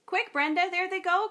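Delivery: excited shout. Acted. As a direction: call out in a rush. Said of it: "cried the boy".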